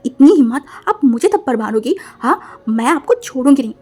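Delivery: loud outraged threat; how dare you, loudly; angry loud challenge. Acted.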